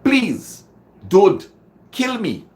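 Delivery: high, loud pleading cry; plea heard by the executioner; desperate begging. Shown as a demonstration. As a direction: beg for your life, high and loud. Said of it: "what the executioner is hearing".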